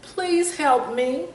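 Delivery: little bird calling for help; shouted plea. A narrator's reading. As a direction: call out for help from the ground. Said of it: "He shouted out loud".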